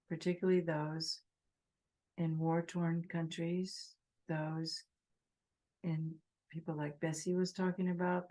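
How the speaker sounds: unhurried phrases with long silences; guided meditation. Real, not acted. Teacher asking meditators to think of all beings, guided meditation. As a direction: guide them gently, leaving space between phrases.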